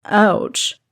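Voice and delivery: mock wounded. A narrator's reading. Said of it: "He acted wounded".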